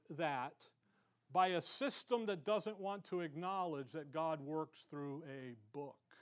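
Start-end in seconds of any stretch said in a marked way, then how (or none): none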